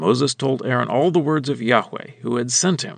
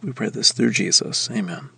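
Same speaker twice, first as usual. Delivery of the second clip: prayer. Real, not acted. Closing prayer before reading.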